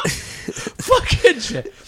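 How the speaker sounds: words stretched out through laughter; laughing. Real, not acted. Still cracking up about the Palladium, laughing.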